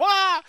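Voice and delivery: very high, loud panicked scream; girlish scream. Retold or imitated. Jehoshaphat chased by Syrians, high loud scream. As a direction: let out a high girly shriek. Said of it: "He's screaming like a girl".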